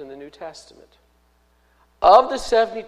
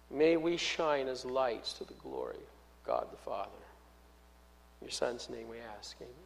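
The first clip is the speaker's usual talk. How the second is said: softer, hushed prayer voice; closing prayer, reverent and soft; 3 semitones lower, 5 dB quieter than usual. Acted. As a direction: pray it quietly to the Father. Pastor leads closing prayer.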